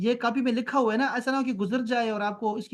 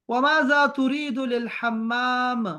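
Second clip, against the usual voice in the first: slow Arabic reading; 3 semitones higher, 3 dB louder, much slower than usual. Shown as a demonstration. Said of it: "teacher reads Arabic line slowly".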